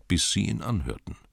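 ordinary delivery

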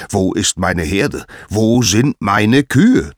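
loud, drawn-out angry demand; furious, booming question; thundering anger. A narrator's reading. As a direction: thunder it out like a wrathful god. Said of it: "donnerte er".

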